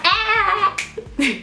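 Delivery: short high giggle; real laugh. Real, not acted. Laughing after hyping up her viewers, high giggle. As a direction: laugh at yourself.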